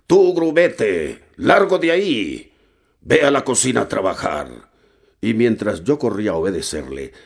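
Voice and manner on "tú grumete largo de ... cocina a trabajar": put-on captain shouting at the cabin boy to get out; captain's shout. A narrator's reading. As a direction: yell the cabin boy off the deck. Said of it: "me gritó".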